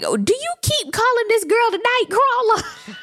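a shocked mock yell at a castmate; incredulous shout. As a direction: yell it at her like she's lost her mind. Incredulous aside at Candace.

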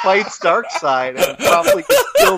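breaking into high laughter mid-line; laughing while reading. Real, not acted. Reading the comic's dialogue aloud to co-hosts, cracking up. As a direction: lose it reading the last words.

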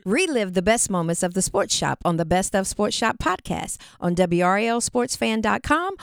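loud promo announcer voice; ad read. Acted. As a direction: sell it like a radio promo.

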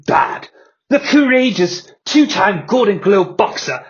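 ordinary talk, nothing marked

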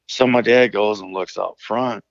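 usual speaking voice

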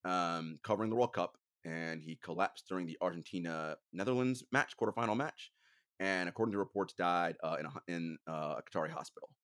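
low, soft and subdued; somber. Real, not acted. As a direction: report a death quietly, voice sinking. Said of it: "a little bit of a somber note".

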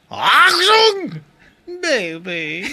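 put-on testing a high German "Achtung" and a questioning "baby"; mock German, unsure. Shown as a demonstration. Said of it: "how's this pronounced?".

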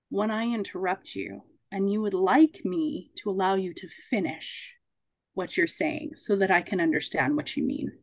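soft, measured reflective listening; gently feed back what you heard, pausing between phrases; quiet, patient mediator; calm rephrasing. Shown as a demonstration.

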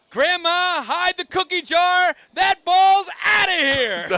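high, booming drawn-out shout; yelled home-run call. Retold or imitated. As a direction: belt it out like an excited ballpark announcer. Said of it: "and I yell out".

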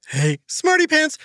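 high, cheery hello; bright greeting. Acted. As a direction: call out brightly to a friend.

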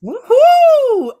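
high, loud woohoo; excited cheer. Real, not acted. Co-host whooping for the guest just introduced, excited cheer.

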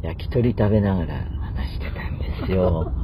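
unvoiced hushed whisper. Real, not acted. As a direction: drop to a secretive whisper. Suddenly hushed retelling of the dinner.

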